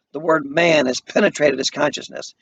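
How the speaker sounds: normal talking voice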